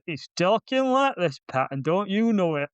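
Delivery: put-on thick Yorkshire accent. Film critic mimicking the lead actor, thick Yorkshire accent. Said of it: "is full on Yorkshireman here".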